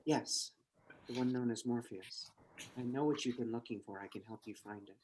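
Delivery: low hushed mysterious woman. Acted. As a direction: speak low and secretive, like a mysterious agent. Trinity voice continues.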